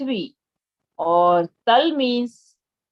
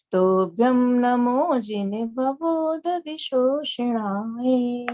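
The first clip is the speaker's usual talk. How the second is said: slow, measured chanting of the Sanskrit verse; slow verse recitation; 3 semitones higher, much slower than usual. Shown as a demonstration. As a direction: recite each line very slowly, syllable by syllable. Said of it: "ध्यान रखना है किस तरह से हमको त्रा पढ़ना है". (धीमा, लयबद्ध श्लोक पाठ)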